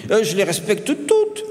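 put-on high, insistent boy; earnest schoolboy protest; with conviction. A narrator's reading. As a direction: insist on it proudly, climbing in pitch. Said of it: "affirma-t-il avec conviction".

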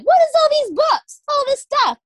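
high, loud amazed squeal; shocked book-box squeal; excited disbelief. Retold or imitated. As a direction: squeal in mock disbelief at the pile. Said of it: "I'm like".

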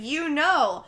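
loud, emphatic retold outburst; retold yell. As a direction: imitate her yelling, not at full volume. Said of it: "yells it, and she's like".

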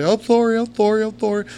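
high, loud, rushed apologizing; panicked apology. Retold or imitated. Teenage narrator apologizing after the bouncers pull him off, panicked pleading. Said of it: "I'm immediately".

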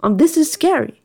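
hammed-up nervous inner voice; brain's voice. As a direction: voice your brain as a jumpy little alarm. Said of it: "my brain is like".

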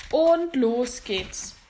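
loud, drawn-out launch call; showman's stretched call; auction kick-off. Acted. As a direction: kick off the bidding with a slow shout.

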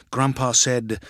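ordinary delivery, nothing marked

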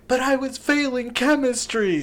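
high, pleading mock wail; hammed-up high-voiced excuse; imitated daughter's protest. Acting out daughter's protest from video.

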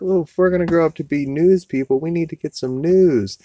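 put-on high, fast, eager kid voice; kid reporter voice. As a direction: chirp it like an excited little kid. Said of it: "and they're like".

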